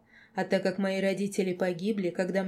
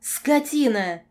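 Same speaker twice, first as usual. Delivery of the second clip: loud, higher-pitched scream; furious shriek; 5 semitones higher, 8 dB louder, slower than usual. A narrator's reading. Girl carried away at the werewolf, shrieking. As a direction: shriek the insult through the house. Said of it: "Визг на весь дом".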